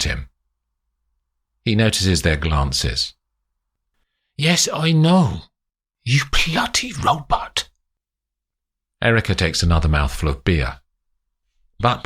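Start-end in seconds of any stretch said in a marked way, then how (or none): 4.4-7.7 angry. A narrator's reading. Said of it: "His anger rises to the surface".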